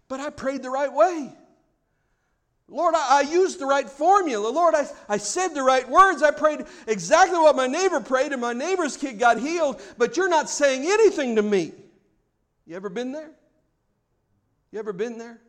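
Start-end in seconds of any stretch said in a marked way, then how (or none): 0.1-11.7 higher-pitched acted complaint to God; hammed-up indignant prayer; aggrieved protest. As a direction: plead your case, voice raised in pitch. Acts out aggrieved unanswered prayer.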